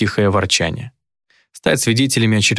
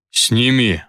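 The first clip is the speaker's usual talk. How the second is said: through gritted teeth. Acted. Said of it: "процедил он сквозь зубы".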